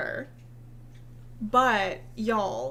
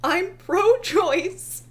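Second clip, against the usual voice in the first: higher, slow and smug; mocking quote; 7 semitones higher, 5 dB louder, much slower than usual. Mimicking the influencer's smug post, slow mock pride. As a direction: drag out "I'm pro-choice" like a proud declaration. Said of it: "she says".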